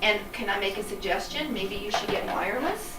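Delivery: ordinary delivery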